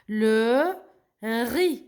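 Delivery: "riz" said ree, no Z; slow teacher's sounding out of "Le riz"; silent Z. Shown as a demonstration. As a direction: stretch "Le" and "riz" apart. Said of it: "Le Z, je le prononce pas".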